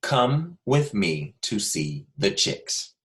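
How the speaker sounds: very slow, every word spaced out; dictation pace. Shown as a demonstration. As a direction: dictate slowly so children can write. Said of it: "Last time".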